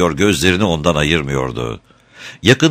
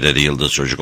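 ordinary delivery, nothing marked

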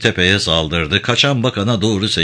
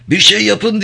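high-pitched desperate shout; panicked minister's high cry; 11 semitones higher, 3 dB louder than usual. A narrator's reading. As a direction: yell for help in a much higher voice. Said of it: "diye haykırdı bakan".

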